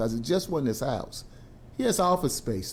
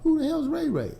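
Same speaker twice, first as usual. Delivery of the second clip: high, incredulous who the hell; mock disbelief; 13 semitones higher than usual. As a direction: scoff at the made-up name. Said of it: "neighbor's retort".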